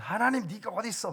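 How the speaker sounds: high, loud mock tirade at God; angry accusing outburst. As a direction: imitate a man railing at heaven, pitch jumping up. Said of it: "막 삿대질하면서". (삿대질하며 대드는 흉내)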